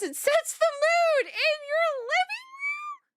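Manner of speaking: high, slow, teasing sing-song; lightly crooned like a romantic ad; mock seductive voice. Streamer teasing chat about the picture, high slow mock croon.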